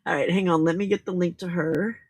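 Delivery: normal talking voice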